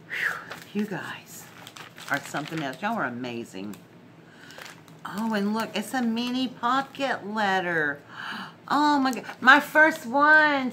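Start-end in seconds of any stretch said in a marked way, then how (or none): 0.1-1.4 breathless whisper, much softer; a soft, awed whisper of thanks. Real, not acted. Overwhelmed by gifts. 8.7-10.7 higher and much louder, slowing on first one; real joyful astonishment; thrilled surprise. Real, not acted. First mini pocket letter.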